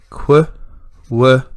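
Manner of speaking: qu and w punched out as separate short sounds; isolated sounds. Shown as a demonstration. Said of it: "we've got the".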